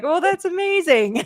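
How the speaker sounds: high-pitched, impressed reaction; retold delight. Mother reacting to son's verdict on the book, retold delight. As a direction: re-enact pleased amazement, pitch lifted well up.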